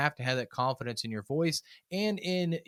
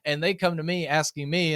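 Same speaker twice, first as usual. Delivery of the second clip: normal talking voice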